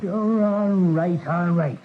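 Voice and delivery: drawn-out, reluctant giving-in; exasperated, slow surrender; grudging concession. Acted. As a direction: give in grudgingly, dragging out the first words. Police officer grudgingly gives in.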